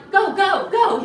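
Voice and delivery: high, loud chant of go; rally the room like a pumped-up host; hyped shout. Retold or imitated. Ethics officer firing up the acting class, loud hyped chant.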